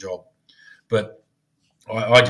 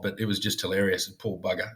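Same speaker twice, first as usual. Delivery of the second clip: ordinary talk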